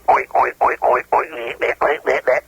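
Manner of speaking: low grunted oinks, pig style; grunting oinks. Shown as a demonstration. Mel Blanc showing the interviewer how Porky grunts, low oinks. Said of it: "he would talk with a grunt".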